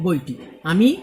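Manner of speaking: ordinary talk, nothing marked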